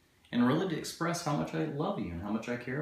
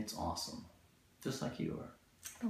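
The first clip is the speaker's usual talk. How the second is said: soft breathy whisper; a husband whispering a compliment to his wife; 2 semitones higher, 9 dB quieter than usual. Real, not acted. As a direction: whisper it tenderly to her.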